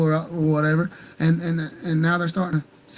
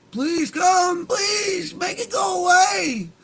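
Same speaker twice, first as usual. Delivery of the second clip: high, slow mocking beg with a whiny shout; mimicking a desperate plea in a much higher voice; mock pleading; 11 semitones higher, much slower than usual. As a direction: beg it out in a high sneering whine, dragging the last words. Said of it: "It went from ... to".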